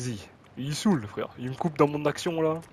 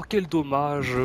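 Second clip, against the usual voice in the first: ordinary talk, nothing marked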